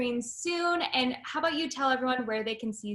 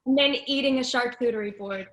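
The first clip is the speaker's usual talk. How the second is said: normal talking voice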